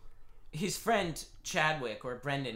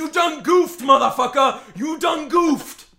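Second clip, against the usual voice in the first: high, loud shouted taunt; mock meme shout; 10 semitones higher, 12 dB louder, slower than usual. Mocking the losing team with a loud meme shout. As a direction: yell it like the viral meme.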